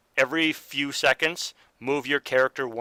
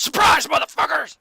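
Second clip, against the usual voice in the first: high, loud battle shout; 6 dB louder than usual. Acted. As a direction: scream it as you lunge. NPC lunges, yells at party.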